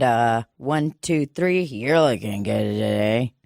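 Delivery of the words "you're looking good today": ventriloquist style, lower; talking with mouth closed. Shown as a demonstration. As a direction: lips frozen, counting in. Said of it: "Let me, uh, tighten up my ponytail".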